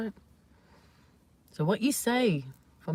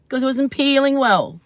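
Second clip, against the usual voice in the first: punchline; 6 semitones higher, 7 dB louder than usual. Acted. Punchline of banana joke.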